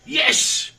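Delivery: high, loud cry of triumph; a real whoop of relief; triumphant yes. Real, not acted. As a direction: cheer as it finally works. Technology finally working.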